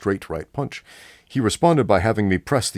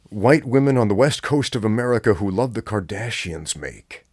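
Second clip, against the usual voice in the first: ordinary delivery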